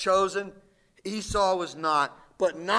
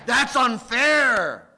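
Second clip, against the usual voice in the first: slow, louder "that's unfair"; indignant objection; 4 semitones higher, 7 dB louder, much slower than usual. Retold or imitated. Preacher voicing the congregation's objection, slow and loud. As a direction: protest like a listener who feels cheated. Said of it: "he says".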